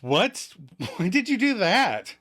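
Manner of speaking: high mock-outraged question; hammed-up incredulous protest; indignant disbelief. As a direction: demand an explanation. Chandler reacts to the confession.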